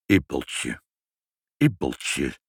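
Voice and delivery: put-on low, deliberate frowning old man; low voice with pauses, searching the memory; pondering Sinterklaas. A narrator's reading. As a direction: muse on the name, low. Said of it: "fronste zijn wenkbrauwen".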